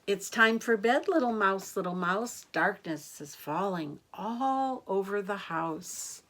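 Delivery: sing-song rhyming read, lifting on each line; bedtime rhyme. A narrator's reading. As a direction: lilt the rhyme to little ones. Reads bedtime rhyme to children.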